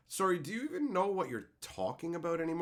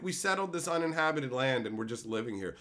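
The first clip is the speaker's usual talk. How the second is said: normal talking voice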